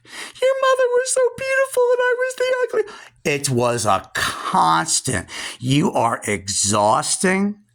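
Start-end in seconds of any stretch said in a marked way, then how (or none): 0.1-2.8 much higher, fast whiny mimicry; mock the jealous aunt's whine; whiny aunt impression. Imitating her aunt complaining about her sister, much higher and fast. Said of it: "That's all I heard my whole life".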